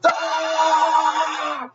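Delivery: long, high battle yell; sustained mock war cry. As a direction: let out one long high-pitched yell. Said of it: "he's just yelling".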